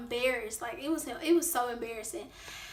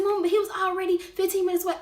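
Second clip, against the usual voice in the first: higher, louder whiny protest; pleading protest; 7 semitones higher, 5 dB louder, faster than usual. Retold or imitated. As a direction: protest to mom, voice rising. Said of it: "I said".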